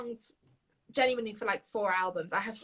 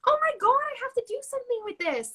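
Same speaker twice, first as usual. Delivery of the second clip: excited discovery; 13 semitones higher, 3 dB louder, faster than usual. Retold or imitated. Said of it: "I was like".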